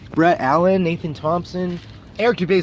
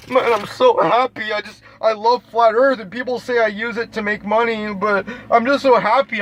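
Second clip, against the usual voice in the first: mock crying; 3 semitones higher than usual. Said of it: "he made a video in his car crying".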